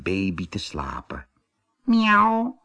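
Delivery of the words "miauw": rising, contented mew; happy meow. A narrator's reading. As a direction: mew with relief like a cat. Kitten meows happily.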